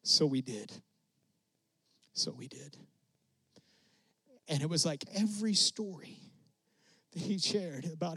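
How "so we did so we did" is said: put-on low, trailing whisper of an old man; imitating Papa Sullivan's quiet whisper; hushed. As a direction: let it fade to a breath, humble and awed. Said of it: "and he goes".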